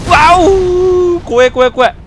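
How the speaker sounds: high, loud alarmed cries; startled fright. Real, not acted. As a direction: yelp in real fright. Projectiles flying at him. (驚いて怖がる叫び)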